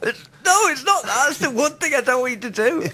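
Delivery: very high-pitched mock protest; hammed-up squealed objection; panicking Father Christmas. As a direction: plead desperately to be left alone. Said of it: "Father Christmas going".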